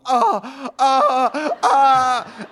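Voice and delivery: high mock groans of pain on the table. On the massage table, hammed-up high groans. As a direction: ham up massage agony, half laughing. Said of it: "I was on the table going".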